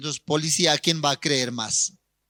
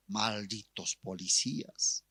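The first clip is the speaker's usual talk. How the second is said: threatening; 3 dB louder than usual. Retold or imitated. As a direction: vow revenge. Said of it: "Amenazó".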